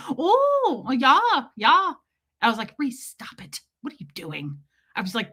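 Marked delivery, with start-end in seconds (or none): 0.1-1.9 high, loud Midwestern lilt; adopted Midwestern accent. Retold or imitated. Traveler catches the stranger's speech, adopted Midwestern accent. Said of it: "you start adopting it". 2.8-4.6 hushed, dropping to a whisper; self-scolding mutter. Retold or imitated. Comedian tells herself to stop copying, hushed self-scolding.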